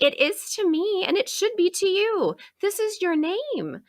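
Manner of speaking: high, insistent upset plea; retold upset. Teacher insisting to kid that his name matters, upset and high. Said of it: "I was so upset".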